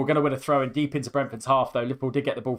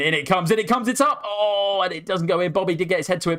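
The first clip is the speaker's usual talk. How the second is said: excited commentary; 6 semitones higher, faster than usual. Real, not acted. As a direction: ride the moment the ball goes up. Corner whipped in, near goal.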